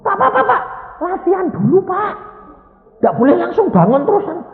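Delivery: high, loud flustered nurse voice; urgently restrain the patient, voice pitched high; imitated panicky nurse; alarmed nurse. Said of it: "susternya kan kebingungan".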